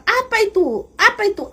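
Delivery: retelling the captors' startled shout; alarmed shout. Retold or imitated. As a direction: cry out in alarm at the sound. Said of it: "mereka teriak".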